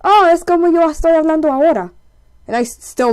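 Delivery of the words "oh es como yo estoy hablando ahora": high, loud mock mom voice in Spanish; imitating mom. Mom answering her child about Salvadorian accents, high loud imitation. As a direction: do an exclaiming mom, pitched up and louder. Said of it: "she was just like".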